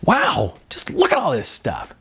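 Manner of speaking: a lightly voiced Jasmine, impressed by the gear; excited wow. Jasmine marvelling at the gear, a lightly voiced excited wow. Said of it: "Jasmine bounded into the room and said".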